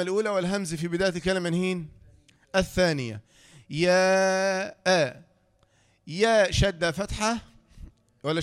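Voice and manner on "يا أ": long-held "يا" before a short "أ"; elongated madd. Shown as a demonstration. Teacher spelling out for a learner, long-held "يا". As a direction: stretch "يا" out, then cut to "أ". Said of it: "ألف مد متصل".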